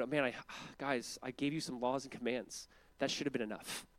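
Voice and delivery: soft, low-energy sighing complaint; acted half-hearted excuse, quietly; weary reluctance. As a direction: sigh and let it drop, mock fed up. Voices a reluctant, weary God.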